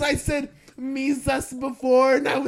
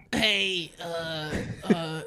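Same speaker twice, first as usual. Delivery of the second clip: developing a character voice; 6 semitones lower, slower than usual. Pretend. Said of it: "I'm trying to develop the voice".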